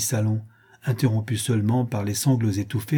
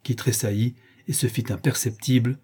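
ordinary delivery, nothing marked

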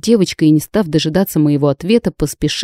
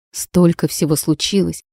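ordinary delivery, nothing marked

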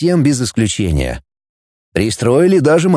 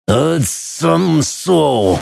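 alien speech; 2 semitones higher, much slower than usual. Acted. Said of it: "капитан сибумского эсминца".

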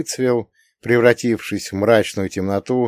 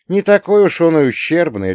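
sharp retort; 8 semitones higher, 4 dB louder than usual. A narrator's reading. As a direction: snap back, raised pitch. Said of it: "резко возразил я".

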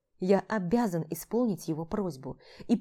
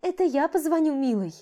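sugary woman; 9 semitones higher, 5 dB louder than usual. Acted. Vlada's last word.

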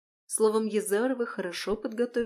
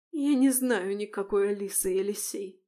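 plain reading voice

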